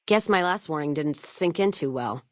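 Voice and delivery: ordinary delivery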